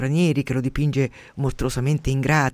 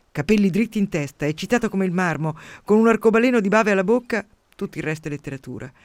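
fiery reading; 3 semitones higher than usual. Acted. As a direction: read the poet's letter with rising fervour. Reading a poet's fiery letter. (lettura declamata, sempre più accesa)

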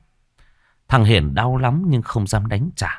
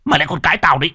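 higher, angry retort; furious outburst; 8 semitones higher, 4 dB louder, much faster than usual. Acted. Husband enraged by his wife's reproach, furious outburst. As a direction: snap back in a rage. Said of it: "Ông Tùng nổi trận lôi đình".

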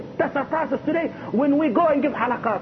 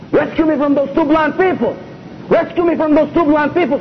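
higher, louder urgent "Rescue me" plea; repeating the dream's plea for rescue, higher and louder; 5 semitones higher, 5 dB louder than usual. Retold or imitated. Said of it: "Three times the Prophet ... tell him".

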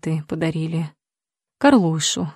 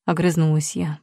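ordinary delivery, nothing marked

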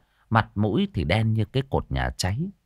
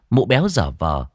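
ordinary delivery

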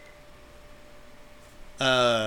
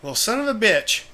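Idahoan drawl; 5 semitones higher than usual. Retold or imitated. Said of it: "what a true Idahoan would say".

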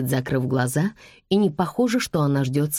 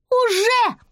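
put-on very high child's jeer; high-pitched impudent retort; cheeky little girl; 4 dB louder than usual. A narrator's reading. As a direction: snort back like a bratty kid. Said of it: "хмыкнула девочка".

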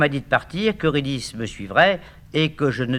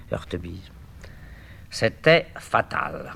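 ordinary delivery

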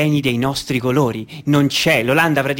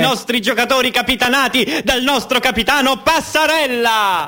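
higher, building announcer call; play-by-play; 9 semitones higher than usual. Acted. Commentator announcing the team entering the pitch, rising excitement. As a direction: build to the captain's name.

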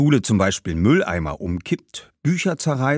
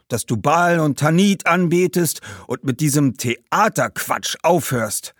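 higher, insistent ancestor; demanding ghost; 8 semitones higher, 3 dB louder than usual. Acted. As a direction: lay down the law in a raised pitch. (fordernder Geist, erhobene Stimme)

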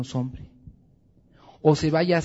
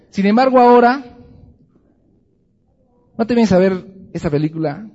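loud scolding; 5 semitones higher, 9 dB louder than usual. Acted. Preacher scolding husbands now.

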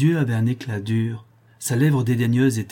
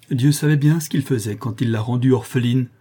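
plain reading voice

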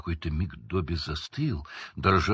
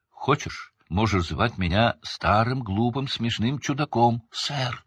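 ordinary delivery, nothing marked

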